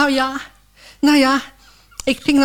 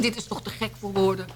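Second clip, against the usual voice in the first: a much softer aside, almost to herself; muttered disbelief; 3 semitones lower, 10 dB quieter, faster than usual. Acted. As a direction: drop the voice on Dit is toch te gek voor woorden. Same line said twice.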